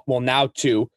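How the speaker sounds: usual speaking voice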